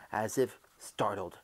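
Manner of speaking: ordinary delivery, nothing marked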